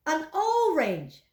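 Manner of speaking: An orange said slowly; slow model pronunciation. Shown as a demonstration. Teacher slowly models An orange for the class. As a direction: stretch An orange out for learners. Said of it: "Mari ikuti saya".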